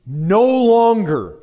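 "No longer" high and loud, drawn out then falling; emphatic command. Real, not acted. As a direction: thunder "No longer" slowly. Preacher repeats command for emphasis.

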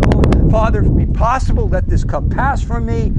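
loud, high, anguished plea; anguished prayer. Acted. Jesus pleading with his Father in the garden, anguished and loud. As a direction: cry out to the Father in agony.

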